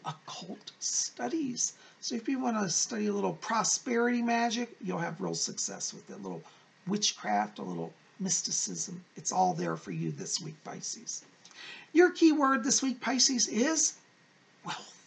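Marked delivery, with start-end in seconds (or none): 0.1-1.7 slow, secretive whisper. Acted. Mysterious hint at occult. 5.2-11.2 much lower, eerie mystic tone; a lightly spooky sorceress. Acted. Spooky talk of witchcraft.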